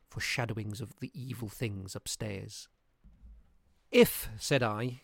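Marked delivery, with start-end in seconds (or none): 3.9-4.3 sharp, raised "If"; emphatic "If". A narrator's reading. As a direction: start the condition with force. Narrator voicing himself, emphatic.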